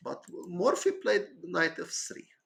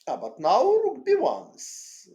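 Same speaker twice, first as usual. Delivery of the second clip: sudden realization; 10 semitones higher, 8 dB louder than usual. Real, not acted. Sudden discovery of a chess idea.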